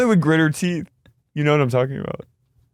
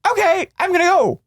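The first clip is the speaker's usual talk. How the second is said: high, airy impression of Gina leaving; high mocking voice; 13 semitones higher than usual. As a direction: toss it off high and breezy.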